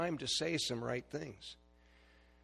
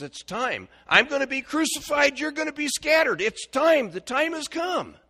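loud, high urgent announcement; Jesus to disciples; 14 semitones higher, 11 dB louder than usual. Acted. Jesus telling his disciples, loud and urgent. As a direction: cry out that the moment has come. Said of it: "He's telling the disciples".

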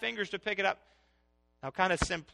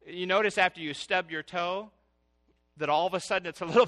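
usual speaking voice

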